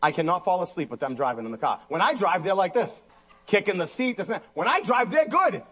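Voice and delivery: ordinary talk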